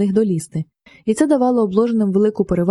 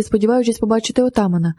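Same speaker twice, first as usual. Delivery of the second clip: ordinary delivery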